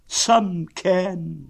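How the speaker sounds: high, loud, aggrieved; pointed high donkey voice; gloomy donkey. Acted. Donkey answering that some can enjoy themselves, gloomy. Eeyore sourly responds to Pooh.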